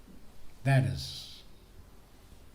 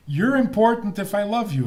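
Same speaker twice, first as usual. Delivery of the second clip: voiced higher and louder, mocking a selfish attitude; lightly mock the selfish view; mock arrogance; 7 semitones higher, 4 dB louder than usual. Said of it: "That is nasty".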